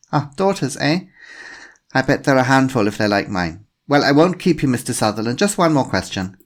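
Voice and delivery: put-on a polite inspector with a faint German accent; slight German accent. A narrator's reading. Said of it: "a woman with a slight German accent".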